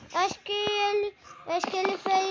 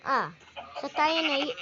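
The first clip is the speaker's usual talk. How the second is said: ordinary talk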